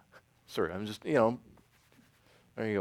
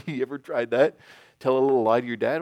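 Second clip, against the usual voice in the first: ordinary talk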